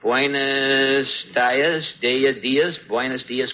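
slowly sounding out "Buenos dias" and "dias"; practicing Spanish. Shown as a demonstration. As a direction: drill the words "Buenos dias" syllable by syllable. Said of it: "Now, let me see".

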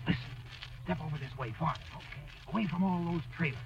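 very soft, conspiratorial hush; secretive whisper. Acted. Mister Wilcox moving friends away from the trailers, furtive whisper. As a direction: usher them away under your breath. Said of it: "What are you whispering that".

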